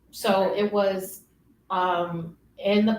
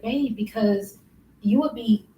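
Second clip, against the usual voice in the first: ordinary delivery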